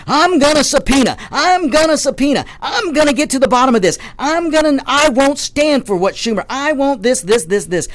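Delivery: high, hammed-up mocking politician voice; mocking impression of a blustering senator; mock Graham boast.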